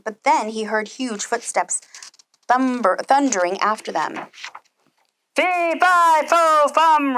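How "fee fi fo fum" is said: loud, higher giant roar. Acted. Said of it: "roared the giant".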